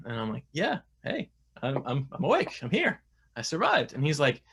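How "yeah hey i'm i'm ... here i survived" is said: high-pitched, eager just-woke-up voice; retold mock-bright wake-up reply; groggy eager reply. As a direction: pop up higher, keen to show you're alive. Retelling waking from surgery.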